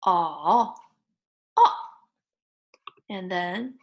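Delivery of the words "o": scoop "o" down and up; third tone. Shown as a demonstration. Teacher drills vowel tones, "o" down then up. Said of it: "third tone goes down and up".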